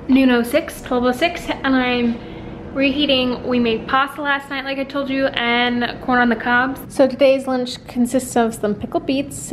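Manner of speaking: ordinary talk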